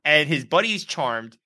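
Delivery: ordinary delivery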